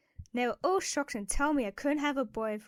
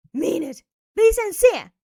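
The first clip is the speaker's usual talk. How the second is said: louder and much higher sharp order; harsh demand; 6 semitones higher, 13 dB louder than usual. Acted. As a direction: bark the order at her. Kidnapper demands a real apology.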